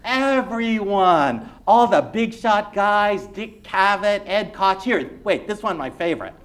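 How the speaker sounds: high, loud, laughing dad; a proud father showing off clippings; gleeful banker dad; boasting immigrant father. Acted. Reader voices the immigrant father.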